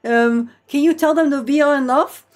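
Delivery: imitated Dutch-accented English; light Dutch accent, halting phone talk. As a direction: mimic a Dutchman hesitantly addressing his chatbot. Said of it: "doesn't help his Dutch accent either".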